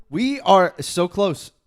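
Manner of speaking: loud, high, slow hype; excited build. Real, not acted. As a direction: stretch it out with excitement. Hyping the moment.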